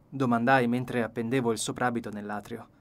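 ordinary delivery

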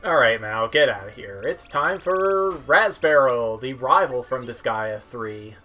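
ordinary talk, nothing marked

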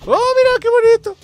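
high, bright burst of delight; excited delight. Real, not acted. Gamer excited at the knives he just threw. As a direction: gush over the new throwing knives.

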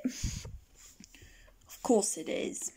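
slow unvoiced whisper; whispered aside. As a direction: breathe it as a sly aside.

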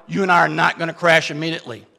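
ordinary talk

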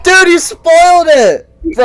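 high, loud, slow fake-outraged wail; mock outrage. Scolding his friend for a spoiler, loud mock outrage. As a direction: moan like the film is ruined forever.

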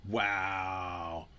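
low, soft, stretched-out wow; drawn-out wow. Pretend. Creator watching his videos vanish, drawn-out wow. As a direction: let the wow hang slowly. Mock reaction to videos gone.

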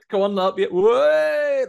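high, loud jeering yell; obnoxious mate yelling. Retold or imitated. Mate mocking the panic, hammed-up loud jeer. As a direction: whoop it up like a careless lad. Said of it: "he does a proper Uncle Knobhead".

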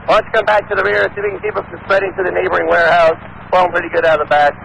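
normal talking voice

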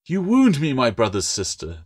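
a proud gecko pretending to be offended; mock indignation. A narrator's reading. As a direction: play the offended gecko, affronted. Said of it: "in indignation".

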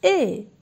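loud ए sounded out for the class; model vowel. Shown as a demonstration. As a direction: call out ए clearly for children to repeat. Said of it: "आप सभी इसका उच्चारण कीजिए".

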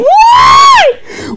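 high, loud wail of why; hammed-up despairing shriek; mock anguish.

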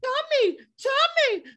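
very high-pitched, sing-out name calling; mimicking movie heroine. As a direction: mimic her calling out in a squeaky falsetto. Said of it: "She said".

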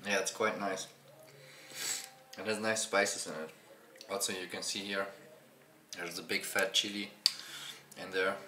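talking with food in mouth; muffled by chewing. Real, not acted. Seen 1: Eats curry just before; mouth moving, hand at mouth mid-words.

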